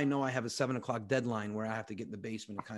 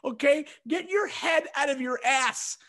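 high-pitched, loud imitation of a scolding wife; hammed-up wife voice, shrieking with outrage; upset wife; 5 dB louder than usual. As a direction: scold him in a mock female wail.

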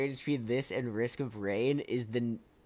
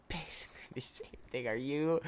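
whispered aside; 9 dB quieter than usual. Real, not acted. Muttered aside while playing.

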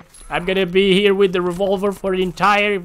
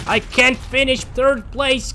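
higher, slow, drawn-out whine; whiny repeat; 9 semitones higher, slower than usual. Real, not acted. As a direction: stretch it out in mock despair.

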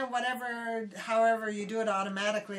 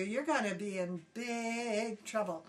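ordinary delivery

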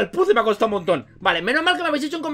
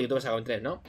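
much lower, softer repeat; low aside; 11 semitones lower, 10 dB quieter than usual. Real, not acted. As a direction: drop it into a quiet side comment. Lo repite aparte en voz normal. (comentario aparte, grave y bajito)

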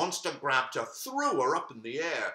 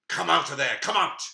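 "Come out" thrown louder, a stern challenge; commanding call; 2 semitones higher, 7 dB louder, faster than usual. Acted. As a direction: order the monster out. Khadija calls into the cave.

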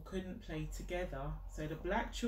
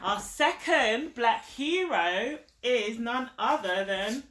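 slow, higher, louder announcement; grand reveal; 5 semitones higher, 8 dB louder, slower than usual. Real, not acted. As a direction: present him like a big prize.

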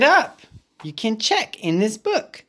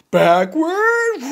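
Gerald voice; 9 semitones higher than usual. Acted. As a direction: drop down for the elephant. Gerald echoes.

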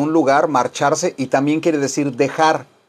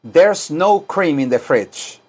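slow, careful English; slow teaching read; slow model sentence; slower than usual. Shown as a demonstration. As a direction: say it slowly for students to repeat. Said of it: "Y la otra digan".

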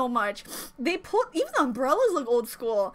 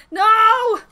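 high, loud cry of protest; loud, dismayed outburst; pleading protest; 6 semitones higher, 8 dB louder than usual. Real, not acted. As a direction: wail it out, wanting them to stay. Protests as the scene ends.

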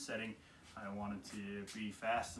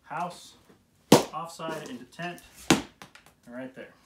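higher, louder drill calls; calling out drill moves; 5 semitones higher, 12 dB louder, much slower than usual. Shown as a demonstration. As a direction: bark each move as you strike. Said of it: "like I said, we're gonna go from".

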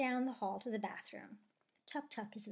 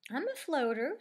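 higher, louder announcement; 5 semitones higher, 5 dB louder than usual. A narrator's reading. Stingray claiming to the others that she floats, loud voice. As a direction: declare it boldly and loudly. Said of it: "in a loud voice".

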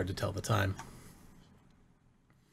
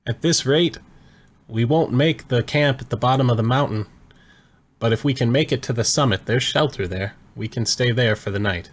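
higher, louder shout into the wind; yelling over wind; 4 semitones higher, 7 dB louder than usual. Acted. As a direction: call out over a storm. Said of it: "He has to yell a little bit to be heard over the wind".

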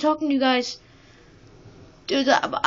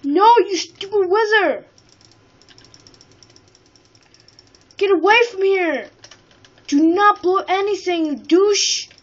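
high, louder, slow angry scolding; angry yelling; 6 semitones higher, 4 dB louder, slower than usual. Real, not acted. Gamer yelling at the wizard to get away, angry. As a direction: chase the wizard off with your voice.

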